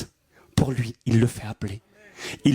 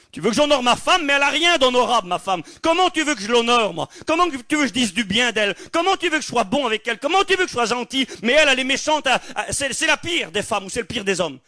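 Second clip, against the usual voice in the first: indignant spouse; 8 semitones higher than usual. Retold or imitated. Preacher voicing a spouse complaining to the church, high and indignant. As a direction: protest that the partner deserves nothing. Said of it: "C'est souvent ce que se disent certains".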